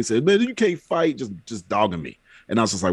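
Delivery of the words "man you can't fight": higher-pitched mocking jab; imitating a kid's taunt, pitched up; retold teasing. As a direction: needle him like a taunting kid, retold. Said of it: "He's making fun of me".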